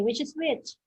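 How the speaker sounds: ordinary talk, nothing marked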